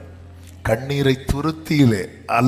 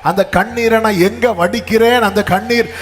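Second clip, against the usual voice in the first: high, loud sermon climax; impassioned preaching; 6 semitones higher, 6 dB louder than usual. Acted. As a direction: drive it home, pitch climbing as you go.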